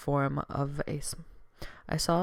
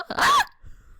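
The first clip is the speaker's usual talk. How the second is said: loud high laugh; 8 dB louder than usual. Real, not acted. Reacts to cute moment.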